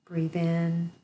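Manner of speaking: normal talking voice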